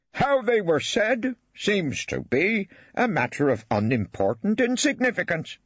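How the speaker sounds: slow, high, ponderous judge voice; weightily. A narrator's reading. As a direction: lay each word down with heavy self-importance. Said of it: "he said weightily".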